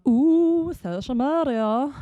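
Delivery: high, drawn-out mock-grand voice; playful put-on voice. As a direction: swoop up high and stretch it out for fun. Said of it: "change the tone of my voice randomly".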